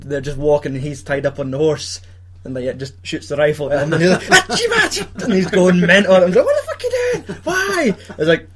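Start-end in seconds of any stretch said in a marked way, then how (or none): 4.2-5.2 high, squeaky laughing achoo sneezes; mock sneezes. Mimicking character's sneezes. 6.4-8.0 high-pitched laughing what the fuck; mock disbelief. Retelling his own shocked reaction.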